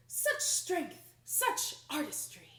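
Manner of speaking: loud admiring exclamations; admiring crowd. Acted. Royal audience praising Annette, admiring exclamations. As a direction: gush with admiration.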